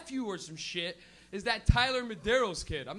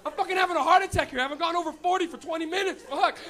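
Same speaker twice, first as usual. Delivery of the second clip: louder and higher, freaking out with laughter; frantic shout at the cops; 5 semitones higher, 8 dB louder than usual. Retold or imitated. Stoned driver shouting at the cops tailing him, frantic. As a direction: yell in panic at the cop car behind you. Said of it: "you're like".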